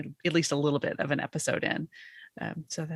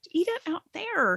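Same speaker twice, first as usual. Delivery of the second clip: scolding squirrels; 11 semitones higher than usual. Retold or imitated. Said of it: "And I'm like".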